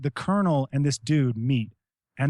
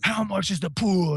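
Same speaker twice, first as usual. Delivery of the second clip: stern demand; 4 semitones higher than usual. Retold or imitated. Confronting pool boy.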